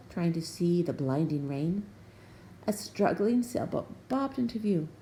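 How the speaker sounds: ordinary delivery, nothing marked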